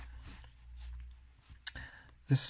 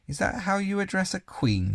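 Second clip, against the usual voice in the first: a higher, stern queen's challenge; 9 semitones higher than usual. A narrator's reading. Queen rebukes Edmund for how he addresses her, stern. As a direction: demand it like an offended queen. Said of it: "looking sterner than ever".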